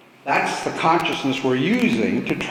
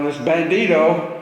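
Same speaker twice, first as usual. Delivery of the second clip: normal talking voice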